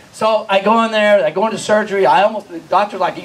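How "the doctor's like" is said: ordinary talk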